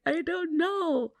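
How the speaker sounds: high, drawn-out mock wail; imitating tearful bewilderment. Wife retelling crying to her husband, mock tearful wail. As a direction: wail it helplessly, acting out the memory. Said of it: "it's freaking me out that I'm crying".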